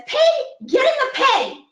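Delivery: put-on high and loud old woman yell. Old woman yells at the pig that won't budge. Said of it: "So she yells at the pig".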